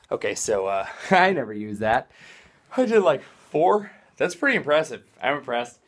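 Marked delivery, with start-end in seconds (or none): none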